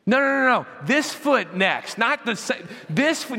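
hammed-up nitpicking parent; mock scolding dad. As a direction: correct a toddler's steps in mock exasperation. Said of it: "scold her and look at her and say".

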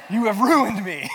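laughing mock despair. To his wife who gave him flu, laughing mock despair. Joking blame at sick wife.

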